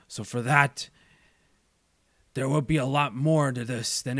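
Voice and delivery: deliberate menace, held in check; Kingpin. Acted. Crime boss threatens everyone his rival loves, controlled menace. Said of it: "say it in my Kingpin voice".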